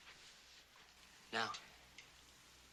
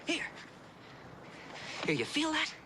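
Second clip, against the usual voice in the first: excited; 9 semitones higher than usual. Real, not acted. Teacher excitedly presses the child's hand to feel speech. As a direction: push her to feel it, thrilled.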